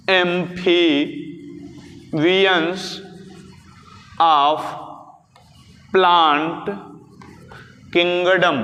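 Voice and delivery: long pauses between words, as if dictating; slow dictation. Shown as a demonstration. Repeats term slowly for note-taking. (एक-एक शब्द रुक-रुक कर लिखवाते हुए)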